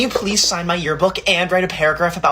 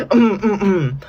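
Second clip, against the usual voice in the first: ordinary talk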